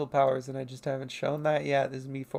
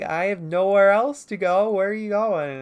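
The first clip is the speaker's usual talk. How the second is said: louder, higher mock forlorn plea; forlorn creature reply; 5 semitones higher, 9 dB louder than usual. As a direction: blurt it out like a lonely stray. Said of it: "He's like".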